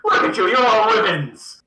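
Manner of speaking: loud, high-pitched jeering taunt; goading taunt. Acted. Pigeon goading the monster into attacking, loud mocking taunt. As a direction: call out and goad them, voice pushed up. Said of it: "continuing to sort of angle into the goading".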